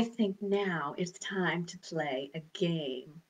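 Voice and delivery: hushed secret whisper. Shown as a demonstration.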